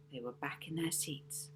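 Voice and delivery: plain reading voice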